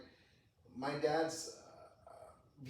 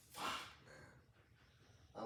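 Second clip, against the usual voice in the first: a near-silent whispered curse, breathy and defeated; whispered resigned swear; 11 semitones lower, 15 dB quieter than usual. Retold or imitated. Retold despair at doctor's advice.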